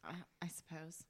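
soft, breathless whisper. Real, not acted. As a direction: whisper it under your breath. Whispered reply.

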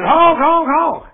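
high, loud mock honking; silly clown. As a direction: honk again like a goofy car horn. Honking at the sign.

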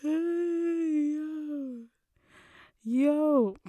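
high, loud disbelieving yo; exclaiming yo. Real, not acted. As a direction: cry out in amused shock. Reacting to shame comment.

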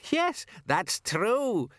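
put-on slow, high old woman cackle; cackling. A narrator's reading. Old woman cackling at a joke. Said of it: "cackled the old woman".

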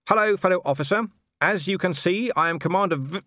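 high, loud official greeting. A narrator's reading. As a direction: hail the guards loudly. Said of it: "Vimes raised his voice".

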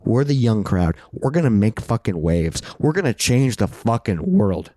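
ordinary delivery

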